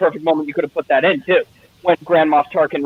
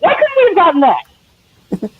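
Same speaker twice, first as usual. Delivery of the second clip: high, drawn-out cry of complaint; slow, high-pitched exasperated plea; mock anguished wail; 13 semitones higher, 4 dB louder, slower than usual. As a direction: wail it like a let-down fan. Mock wail over the missed scene.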